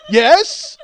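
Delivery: high, loud comic outburst; hammed-up emphatic answer; shouted yes. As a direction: blurt it out at full volume. Acted outburst in bit's punchline.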